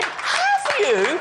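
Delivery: very high, loud incredulous question; hammed-up amazement from the passenger; imitated astonishment. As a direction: squeal it as an amazed listener.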